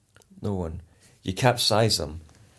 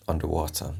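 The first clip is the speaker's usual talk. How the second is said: usual speaking voice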